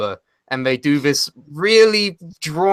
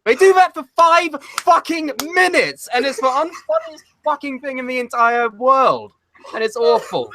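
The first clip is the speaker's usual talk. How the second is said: pitched-up exasperation about the segment; exasperated rant; 10 semitones higher than usual. Real, not acted. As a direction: rant in mock outrage. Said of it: "exasperated rant".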